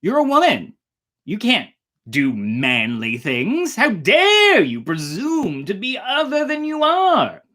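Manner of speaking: high, loud scornful parent; mocking the father. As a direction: sneer out the father's lecture. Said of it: "A parenting moment".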